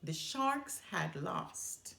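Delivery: ordinary delivery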